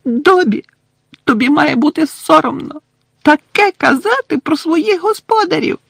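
high squeaky elf voice, reproachful; scolding house-elf Winky. Acted. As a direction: scold like an offended little elf.

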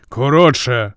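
loud, abrupt interruption; barked command. A narrator's reading. Zeus orders the rambling jester to hurry, barked command. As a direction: cut him off with a sharp bark. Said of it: "гаркнув Зевс".

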